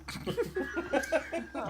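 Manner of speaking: high laugh. Real, not acted. GM cracks up at player's remark, high laugh.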